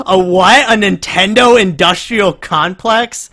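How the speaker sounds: can't believe what he just heard; incredulous disbelief. Real, not acted. Incredulous at the clip.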